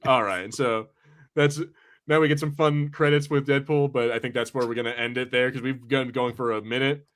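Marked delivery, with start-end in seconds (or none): none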